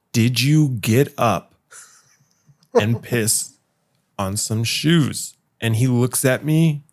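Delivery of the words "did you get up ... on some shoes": slow, with long pauses between phrases; deliberate questioning. Retold or imitated. Waking a friend about the shoes, slow deliberate interrogation. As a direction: spell out the accusation bit by bit.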